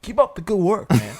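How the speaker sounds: mock-intense pat on the back; intense praise. Stranger shaking the big guy's hand, intense praise. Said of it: "he was so intense".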